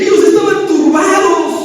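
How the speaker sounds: ordinary talk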